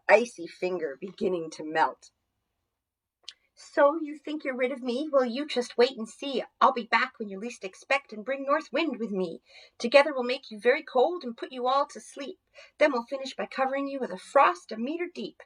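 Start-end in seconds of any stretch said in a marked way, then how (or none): 3.6-15.4 higher voiced icy villain, menacing; angry threat. Jack Frost threatening the children as he leaves, angry. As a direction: taunt them, promising revenge. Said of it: "looking very angry".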